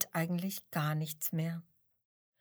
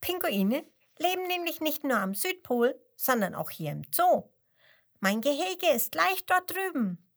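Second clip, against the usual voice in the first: eager penguin; 7 semitones higher, 4 dB louder than usual. A narrator's reading.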